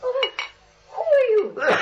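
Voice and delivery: startled challenge. Acted. Aunt startled by strange intruders.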